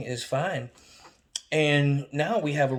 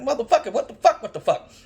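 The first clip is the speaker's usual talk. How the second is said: much higher, loud cursing; exasperated outburst; 10 semitones higher, 7 dB louder, faster than usual. Retold or imitated. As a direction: swear it in disbelief. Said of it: "I'm like".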